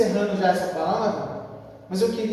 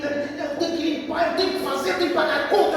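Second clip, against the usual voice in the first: higher-pitched, mock-harried list of chores; fretful busy housewife; 6 semitones higher, faster than usual. Preacher imitates anxious sisters listing their duties, fretful. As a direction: rattle off chores like an anxious homemaker.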